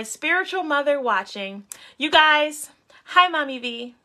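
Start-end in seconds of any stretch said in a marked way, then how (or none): 2.0-3.9 louder, bright hello to mom; excited greeting. Real, not acted. Greeting her spiritual mother watching live, excited. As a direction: call out happily to her.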